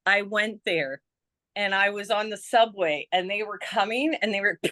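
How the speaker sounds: ordinary delivery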